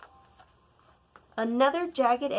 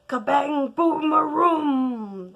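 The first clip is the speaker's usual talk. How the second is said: loud booming thunder sound; 5 semitones higher, 9 dB louder, slower than usual. A narrator's reading. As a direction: boom it out like thunder. Narrator mimics thunder.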